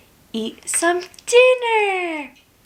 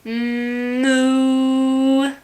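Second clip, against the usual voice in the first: long, level mooing; drawn-out playful moo on one note; cow moo; 3 semitones lower than usual. Pretend. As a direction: imitate a cow for small children. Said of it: "A cow says".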